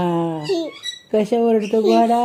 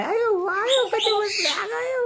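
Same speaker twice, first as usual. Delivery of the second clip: put-on high, soft exclaiming over a child; teasing high-voiced astonishment; mock dismay; 10 semitones higher, 4 dB quieter, slower than usual. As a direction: fuss playfully in a pitched-up voice. Exclaiming at child's funny sitting.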